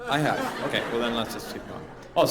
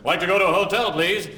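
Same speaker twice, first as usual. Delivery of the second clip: loud, higher tourist English; 7 semitones higher, 5 dB louder than usual. Retold or imitated. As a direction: speak up so the foreigner gets it. Talking to taxi driver.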